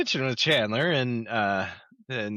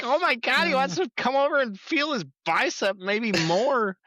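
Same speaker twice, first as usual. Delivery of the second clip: excited squeal; 12 semitones higher than usual. Retold or imitated. Rachel voiced, shocked excitement.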